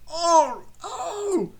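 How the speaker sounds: high-pitched, loud groaning "oh"; groaning trucks. A narrator's reading. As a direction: wail out a squeaky groan of protest. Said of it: "groaned the trucks".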